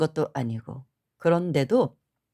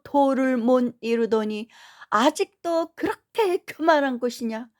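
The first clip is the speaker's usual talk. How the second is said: retold scolding; 5 semitones higher, 3 dB louder than usual. Monk scolding her for past-life pride, stern rebuke. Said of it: "막 야단을 치시면서".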